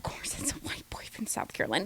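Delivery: hushed gossiping whisper; whispered aside. Real, not acted. Host gossiping about the new bombshell in a whisper. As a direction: whisper it like a secret.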